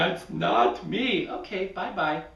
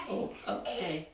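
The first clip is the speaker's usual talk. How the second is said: plain reading voice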